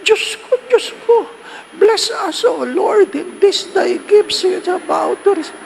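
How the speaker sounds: high, loud frantic praying; mock panicked prayer. Parishioner praying in panic as the ground shakes, loud and high. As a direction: pray in a hammed-up panic.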